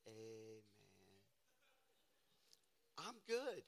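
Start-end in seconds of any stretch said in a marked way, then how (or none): 0.1-0.6 quiet amen. Retold or imitated. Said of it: "I'm gonna say".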